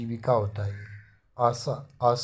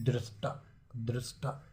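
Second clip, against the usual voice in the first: soft repeat; 9 dB quieter than usual. Shown as a demonstration. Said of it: "वो नहीं".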